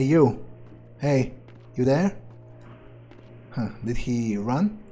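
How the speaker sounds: ordinary talk, nothing marked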